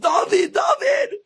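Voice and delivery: very high mock shout of a name; high comic holler; retold scream. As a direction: call up to wake someone, hammed-up. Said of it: "And he screams up".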